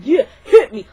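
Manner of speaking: loud, laughing dare; crazy tough yell. Shown as a demonstration. Teaching kids to scare a bully, loud crazed dare. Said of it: "Look all crazy".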